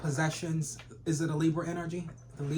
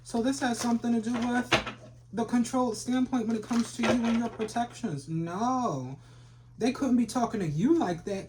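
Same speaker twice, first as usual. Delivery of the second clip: sassy high loud; 6 semitones higher, 4 dB louder than usual. Acted.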